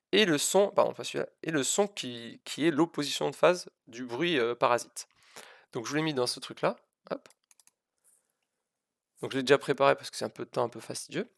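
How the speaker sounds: usual speaking voice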